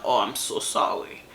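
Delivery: breathy, hushed fake sorry, barely voiced; mock apology. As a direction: toss off a sarcastic, half-whispered sorry.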